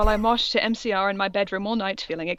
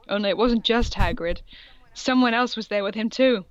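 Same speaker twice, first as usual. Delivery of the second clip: usual speaking voice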